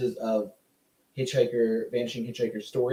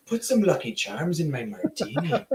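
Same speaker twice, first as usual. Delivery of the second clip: higher, jokey Irish lilt; Irish-sounding spy ordering a drink; mock Irish accent; 4 semitones higher than usual. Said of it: "That's Irish".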